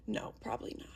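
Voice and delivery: whispering aside; whispered. Real, not acted. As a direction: drop to a whisper. Doubtful aside to self.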